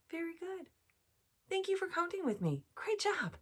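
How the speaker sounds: put-on high, excited praise for kids. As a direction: cheer them on for counting. Praising children.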